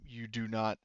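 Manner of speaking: usual speaking voice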